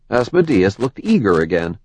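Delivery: ordinary delivery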